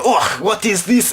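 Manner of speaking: high, slow, mock French accent. Scottish men stand up from back row, accidental French accent. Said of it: "that's a French accent".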